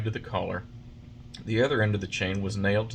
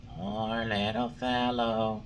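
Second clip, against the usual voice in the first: slow, gentle glowworm voice with pity; a lightly voiced tiny glowworm, pitying; much slower than usual. A narrator's reading.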